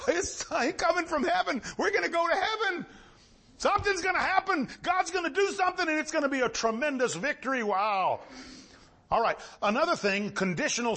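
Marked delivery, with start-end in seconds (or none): 0.1-7.6 high, fast, shouting with a laugh; joyful preaching. Real, not acted. Preacher celebrating the coming victory with the church, high and exultant. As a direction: burst out in joy about heaven. Preacher rejoices over Christ's return.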